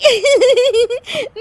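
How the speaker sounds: loud high-pitched giggling fit; high giggle. Acted. Daughter-in-law laughing at mother-in-law's pain, high giggle. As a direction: burst into a shrill-free high giggle.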